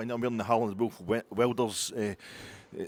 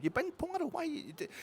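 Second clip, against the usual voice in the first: very high squeaky mock Belgian accent. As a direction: shriek it in a hammed-up Belgian accent. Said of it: "Sushi's accent".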